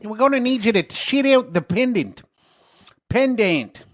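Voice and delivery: exaggerated high New Zealand voice; high-pitched New Zealand accent. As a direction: do a silly New Zealand police officer. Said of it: "New Zealanders talk with a real high pitch".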